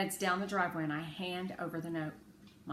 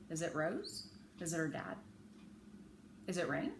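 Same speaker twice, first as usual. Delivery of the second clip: usual speaking voice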